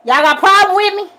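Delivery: retold loud confrontation; loud challenge. Challenging the sheriff.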